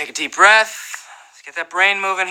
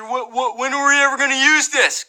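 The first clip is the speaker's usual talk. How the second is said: higher-pitched mock student voice; put-on schoolkid protest, pitched up; complaining student; 4 semitones higher than usual. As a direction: imitate a griping pupil questioning the math teacher. Mimics student questioning math teacher.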